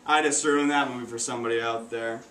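ordinary talk, nothing marked

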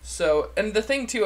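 ordinary talk

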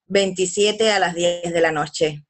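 ordinary delivery

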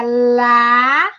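"lá" held long, pause, then "ran"; syllable by syllable. Shown as a demonstration. Teacher showing how to write it, separated syllables "lá" and "ran". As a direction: dictate LÁ... RAN as separate beats. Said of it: "olha só como é que vai ficar".

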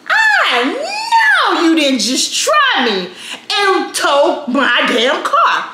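high, drawn-out shout of outrage; furious outrage. Retold or imitated. Woman swinging open the office door, furious outrage. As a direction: stretch every word in acted fury. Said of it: "I'm angry, my adrenaline's pumping".

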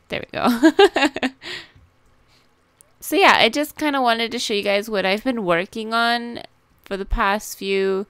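usual speaking voice

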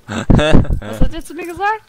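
loud baffled question; outraged disbelief; indignant disbelief. Real, not acted. Baffled by insult in chat.

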